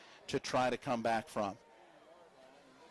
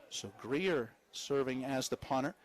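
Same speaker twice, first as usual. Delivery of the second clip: ordinary talk, nothing marked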